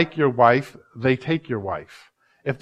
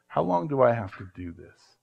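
quieter plea; 3 semitones lower, 6 dB quieter than usual. Retold or imitated. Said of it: "I'm crying and you aren't paying attention".

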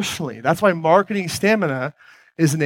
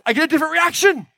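much higher pitched, lifted voice; different tone of voice; 11 semitones higher than usual. Shown as a demonstration. Speaker on stage demonstrating how a higher tone changes the reaction, high-pitched voice. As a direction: jump the voice way up high to show the contrast. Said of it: "And when I speak like this".

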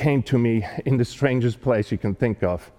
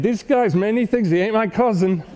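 indignant retort; 8 semitones higher than usual. Retold or imitated.

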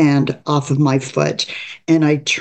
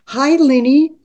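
much higher, bright forced cheer over emptiness; fake cheerful; 10 semitones higher than usual. A narrator's reading. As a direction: smile through it to hide the pain. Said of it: "Trying to sound as though I cared".

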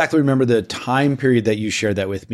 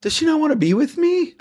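dawning doubt. Retold or imitated. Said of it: "I was just like".

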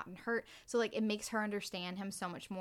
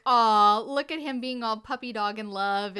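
loud, laughing aww over a lovestruck guy; cooing tease; 10 dB louder than usual. Retold or imitated. Friend teases the lovesick husband, cooing loudly through laughter.

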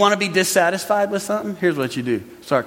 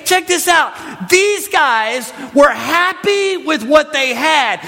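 preaching shout; 7 semitones higher, slower than usual. Real, not acted. Preacher shouts to the congregation to look closer.

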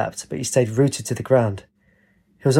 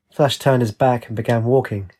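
usual speaking voice

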